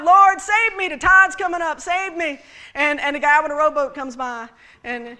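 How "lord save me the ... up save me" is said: mock crying out. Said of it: "she's crying out".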